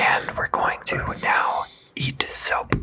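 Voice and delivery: slow ASMR whisper; slow whisper for the mic. Pretend. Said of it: "A S M R channel".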